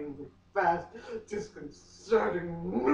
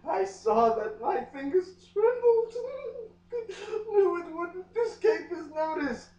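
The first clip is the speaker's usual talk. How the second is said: much higher, laughing and crying; laughing through tears; 12 semitones higher than usual. Acted. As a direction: crack up while reading. Reader overcome with emotion.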